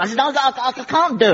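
high, stammering frustration; frustrated. Acted. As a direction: give up in a high-pitched fluster. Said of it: "Don't get frustrated".

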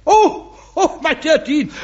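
high-pitched, effusive backtracking; flustered realisation. Acted. Bishop realising he misunderstood the Dean, flustered. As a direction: jump high as the penny drops.

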